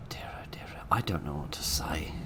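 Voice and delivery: hushed, breathy whisper to himself. Real, not acted. Gamer whispering to himself after a failed kill. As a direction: murmur it under his breath.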